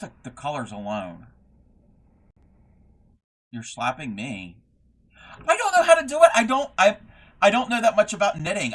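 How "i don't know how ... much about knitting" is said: high, loud flustered outburst; flustered protest. Real, not acted. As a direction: burst out loudly in high-pitched protest. Mock protest at being slapped.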